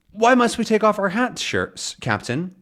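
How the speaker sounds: high, fussy detective voice; high Thompson voice. Acted. Thompson questions Captain's calculations, high and fussy. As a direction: pitch it up like a prim detective. Thompson asks about hats.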